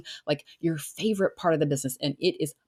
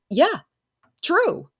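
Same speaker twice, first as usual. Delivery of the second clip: eager agreement; 7 semitones higher than usual. Retold or imitated. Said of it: "you're coming in there to say".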